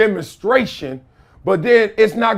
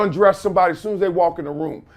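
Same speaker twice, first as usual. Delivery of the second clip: normal talking voice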